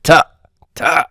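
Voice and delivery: throaty constricted T sound; Ta, ta as a pharyngealized T; emphatic T. Shown as a demonstration. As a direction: tighten the throat on Ta, ta. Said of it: "a T with constriction in the throat".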